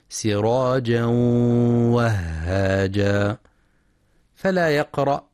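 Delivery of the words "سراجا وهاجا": سراجا وهاجا with the tanween merged and a held nasal hum; nasal merging. Shown as a demonstration. Teacher models the correct reading with nasal ghunna. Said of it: "ينتبه القارئ لغنة الإدغام".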